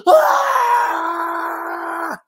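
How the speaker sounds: high, sustained aah; gasp, then hold a loud scream; emphatic scream. Shown as a demonstration. Storyteller demonstrating the scream for emphasis, long aah. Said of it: "it helps when I say".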